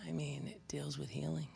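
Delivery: soft whispered aside. Real, not acted. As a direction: lean in and whisper it. Sudden aside to the congregation.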